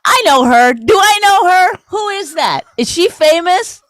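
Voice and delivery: hammed-up eager husband questions; mock excited wondering. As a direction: ham up a husband sure he knows the actress. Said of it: "He'll be like".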